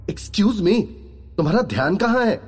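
put-on high-pitched, brisk girl's voice; indignant girl. A narrator's reading. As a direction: call him out in a high voice. Girl snaps at inattentive receptionist.

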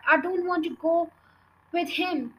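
mild, high-pitched shout; sudden shout. A narrator's reading. Matilda refusing to leave with family, mildly shouting. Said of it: "Matilda shouted suddenly".

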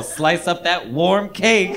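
stretched, mock-savoring tone; slow teasing. Friend slowly teasing Nicole about slicing cake. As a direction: drag it out like a tease.

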